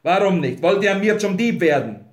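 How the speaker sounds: loud, high accusing questions; angry, affronted demand; indignant. A narrator's reading. Grinley accuses Wolf of theft.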